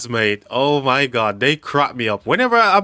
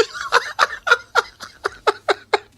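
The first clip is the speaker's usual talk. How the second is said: real, very high, wheezing laugh; shrieking laugh. Real, not acted. Streamer loses it at chat's joke, shrieking with laughter. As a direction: lose it completely at the joke. Chat joke about getting away with abuse.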